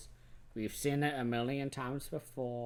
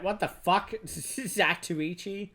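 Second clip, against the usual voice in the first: higher-pitched, exasperated disbelief; raised-pitch mock indignation; incredulous outburst; 7 semitones higher, 3 dB louder than usual. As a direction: demand an explanation in mock outrage. Host acts out disbelief at Zatoichi.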